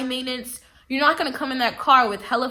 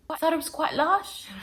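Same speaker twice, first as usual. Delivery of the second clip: higher, softer mock British; badly faked British accent, pitched up; terrible British accent; 4 semitones higher, 7 dB quieter than usual. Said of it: "my terrible British accent".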